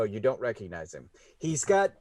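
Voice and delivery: ordinary delivery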